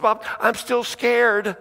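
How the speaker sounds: put-on high, drawn-out childlike complaint; scared child. Girl back downstairs to her mom, scared appeal. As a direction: imitate a child appealing to a parent, slowly.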